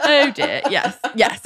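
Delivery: real amused laugh under the words. Real, not acted. Co-host clarifying the elevator fall, laughing.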